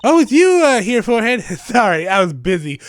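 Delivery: put-on high, bright young magician girl; voicing the girl sidekick, perky and high; cheery girl voice. Voices Trucy in game dialogue.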